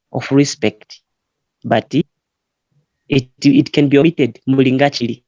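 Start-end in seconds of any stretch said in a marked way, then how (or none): none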